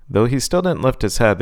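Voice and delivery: ordinary delivery, nothing marked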